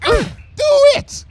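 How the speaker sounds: high, loud desperate shriek. Acted. Bon Bon shrieking at Lyra to cast the spell. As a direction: scream it with everything. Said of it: "shrieked Bon Bon".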